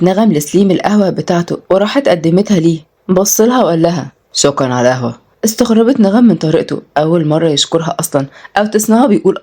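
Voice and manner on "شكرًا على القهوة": man's voice. A narrator's reading. Selim thanks her.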